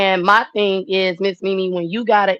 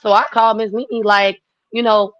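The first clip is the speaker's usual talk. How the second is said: ordinary talk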